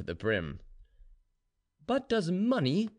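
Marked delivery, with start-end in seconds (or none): 1.9-2.9 high-pitched, pressing question. A narrator's reading. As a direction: needle him with a high, insistent question. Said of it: "pressing Ivan more and more".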